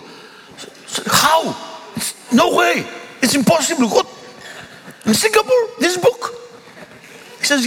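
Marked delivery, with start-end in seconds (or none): 1.1-6.3 much higher, near shouting astonishment; can't believe it, voice flying up; amazed disbelief. Retold or imitated. Pastor stunned his nephew found the book, high astonished cries.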